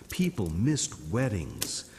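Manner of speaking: ordinary delivery, nothing marked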